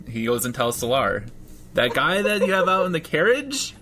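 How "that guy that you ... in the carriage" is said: high-pitched earnest rookie voice; higher voice, halting report with a chuckle in it; naive rookie cop. Acted. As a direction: report to a superior in a high, eager voice. GM voicing Joey reporting to Solar.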